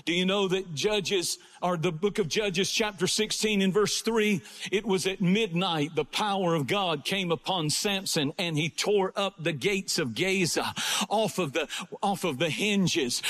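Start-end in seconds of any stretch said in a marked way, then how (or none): none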